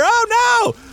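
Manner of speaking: high-pitched cry of dismay; hammed-up alarm, voice shooting up; mock panic. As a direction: yelp it as pretend disaster strikes. Acting out the experiment catching fire.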